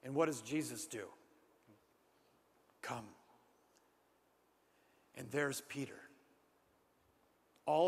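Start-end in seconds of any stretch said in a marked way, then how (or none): 2.8-3.2 soft invitation. Retold or imitated. Jesus calls Peter.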